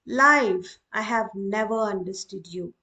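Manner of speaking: addressing life. Shown as a demonstration. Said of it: "For example".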